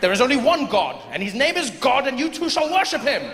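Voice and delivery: high, pompous British officer; pompous fussy courtier. Acted. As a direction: declare it with lofty certainty. British soldier declaring.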